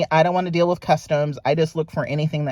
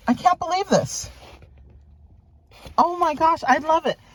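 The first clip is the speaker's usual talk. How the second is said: delighted; 11 semitones higher than usual. Real, not acted. Delight at camellia motif.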